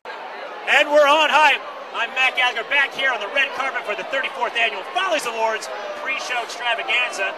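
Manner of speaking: bright, eager red carpet host; gushing red carpet chatter. Acted. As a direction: gush like an awards-show host. Red carpet host pre-show.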